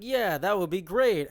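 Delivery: mock gushing, high and slow; fake excitement. Mimicking friends' exclamation-point texts, fake excitement. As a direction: overdo the enthusiasm, drawn out and loud. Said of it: "it's like a fake excitement".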